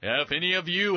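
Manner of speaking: lightly voiced high, proclaiming tone; a hint of a preacher opening a pronouncement; Jesus. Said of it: "Jesus gets up and he goes".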